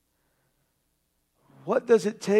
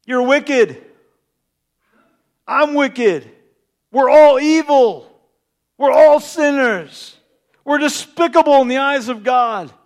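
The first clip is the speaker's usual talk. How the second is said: loud, emphatic sermon call; full-force preaching; fiery preaching; 2 semitones higher, 6 dB louder than usual. Acted. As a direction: hammer the sin home.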